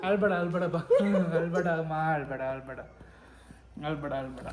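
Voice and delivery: soft, low comforting murmur; tender, quiet reassurance; soothing. Real, not acted. Son comforts his crying mother.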